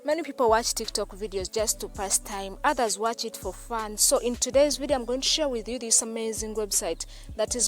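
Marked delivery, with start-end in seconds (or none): none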